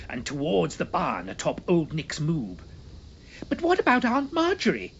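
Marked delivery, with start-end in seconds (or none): 3.5-4.9 high, loud alarmed gasp; boy gasping a worried question. A narrator's reading. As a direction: blurt it out breathless. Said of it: "Simon gasped".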